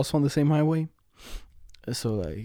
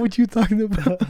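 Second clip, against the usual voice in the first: laughing; 7 semitones higher than usual. Retold or imitated. Laughing retold reply.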